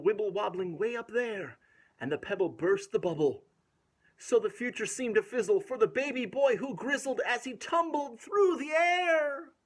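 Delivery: ordinary delivery, nothing marked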